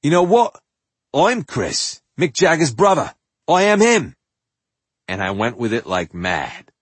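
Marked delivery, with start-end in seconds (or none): 0.0-4.1 high, loud, cocky declaration; brash boast. Retold or imitated. Sixteen-year-old telling kids he is Jagger's brother, loud, high and cocky. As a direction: announce it like a rock star revealing himself.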